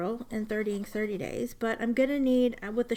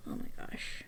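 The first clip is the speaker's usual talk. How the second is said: awed whisper; 8 dB quieter than usual. Real, not acted. Admiring the scroll work.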